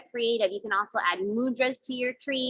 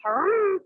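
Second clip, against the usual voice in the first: high-pitched walrus huff; playful imitated walrus breath; walrus harumph; 8 semitones higher, 3 dB louder than usual. Shown as a demonstration. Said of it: "harumph out of your walrus mouth".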